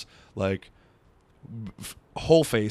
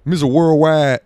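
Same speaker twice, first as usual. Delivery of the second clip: high, loud hype-man rapper voice; brash Miami rap-star swagger, pumped up; 3 semitones higher, 6 dB louder than usual. Pretend. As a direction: boast like a party rapper on the mic. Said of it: "Just me doing [name]".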